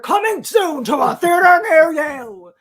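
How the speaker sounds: loud hype-man announcement; wrestler hype voice. Retold or imitated. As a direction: sell it like a wrestling promo. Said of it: "wrestler voice, and he's like".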